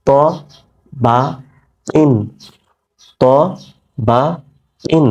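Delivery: ত, বা, ইন spaced out with long pauses between; slow syllable drill. Shown as a demonstration. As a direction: spell the reading out slowly for students. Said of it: "উচ্চারণ কি হবে?".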